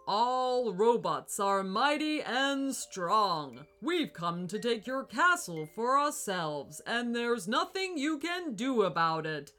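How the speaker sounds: slow, loud, mechanical robot boom. Acted. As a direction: boom it out like a giant evil robot. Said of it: "boomed the giant robot".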